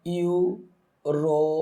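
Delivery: European drawn out very slowly as ইউ-রো-পি-আন; syllable by syllable. Shown as a demonstration. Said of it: "তাহলে আমরা spelling করি".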